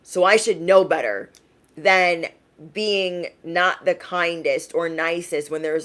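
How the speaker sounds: usual speaking voice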